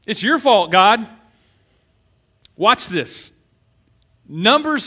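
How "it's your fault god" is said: much higher, loud accusing yell; angry blaming shout. Pretend. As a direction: hurl the blame up at God. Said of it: "and we say".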